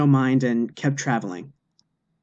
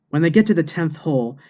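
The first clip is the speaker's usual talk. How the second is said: plain reading voice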